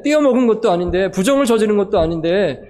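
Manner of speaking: normal talking voice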